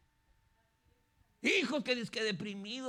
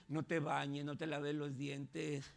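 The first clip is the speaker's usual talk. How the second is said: much lower, softer, slow insinuating voice; whispering tempter voice; 7 semitones lower, 5 dB quieter, slower than usual. Acted. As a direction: lure them lazily like a tempting inner voice. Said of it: "viene la voz y le dice". (voz interior tentadora, baja y lenta)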